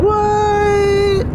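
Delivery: shocked high cry; surprised. Real, not acted. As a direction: blurt it out in disbelief.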